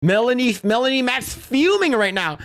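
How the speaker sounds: ordinary delivery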